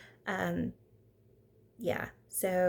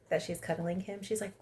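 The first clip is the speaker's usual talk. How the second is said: ordinary talk, nothing marked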